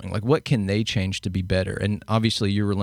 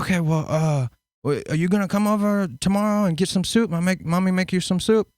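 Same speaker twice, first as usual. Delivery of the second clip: higher, doting mother offering soup; imitating sweet mom; 9 semitones higher than usual. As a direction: play the suddenly tender mom, pitched up. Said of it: "talking to me in a lower registry".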